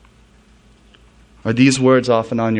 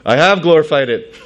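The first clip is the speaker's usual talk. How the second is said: loud, higher proclamation; voice from heaven; 5 semitones higher, 7 dB louder than usual. Acted. God answers his Son from heaven, loud proclaiming voice. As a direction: declare it loudly from on high. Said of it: "Then a voice came from heaven".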